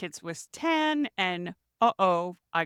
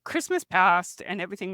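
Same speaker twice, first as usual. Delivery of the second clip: teary; 3 semitones higher than usual. Real, not acted. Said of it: "it makes me teary".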